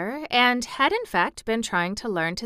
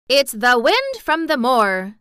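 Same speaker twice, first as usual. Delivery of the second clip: put-on girl laying on broad Yorkshire, louder; 5 semitones higher, 8 dB louder, slower than usual. A narrator's reading. Mary showing off Yorkshire to Colin, broad. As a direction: make it as broad as you can. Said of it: "She said it as broadly as she could".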